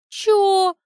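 high, loud, baffled yelp; incredulous what. A narrator's reading. Lyudmila baffled by compliment. (изумлённое «Чё?»)

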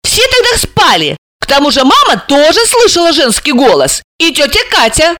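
high-pitched insistent argument; cross. A narrator's reading. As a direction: argue back, piqued. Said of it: "рассердилась Алиса".

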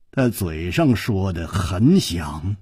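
usual speaking voice

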